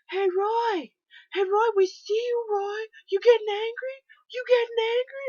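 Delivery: high, loud mock heckling; imitating rowdy hecklers; jeering fans. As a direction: taunt the coach from the stands. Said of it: "they were screaming Patrick Roy".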